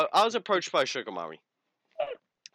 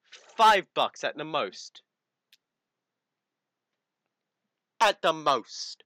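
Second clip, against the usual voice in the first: dragged out, louder, every word leaned on; slow emphatic offer; 3 semitones higher, 6 dB louder, much slower than usual. Retold or imitated. Lowballing the scammer, slow and pointed emphasis. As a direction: spell out the insult slowly.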